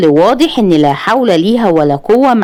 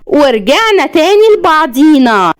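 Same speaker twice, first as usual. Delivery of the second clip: high, drawn-out playful flourish; theatrical; 9 semitones higher than usual. A narrator's reading. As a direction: declaim it slowly like a hammed-up stage line. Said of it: "بطريقة مسرحية وهي بتضحك". (بطريقة مسرحية مرحة)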